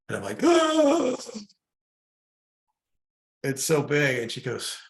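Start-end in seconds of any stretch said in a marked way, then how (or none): 0.4-1.2 high, loud cry of overwhelm; a loud, high gasp-cry of awe. Retold or imitated. Overwhelmed by the growing love. 3.4-4.2 higher and louder, in amazement; overwhelmed awe, pitched up and louder; awed and overwhelmed. Retold or imitated. Overwhelmed by the growing love.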